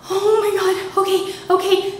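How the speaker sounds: high, anxious voice; frightened assent. Retold or imitated. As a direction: agree while barely holding back panic. Agreeing in fear.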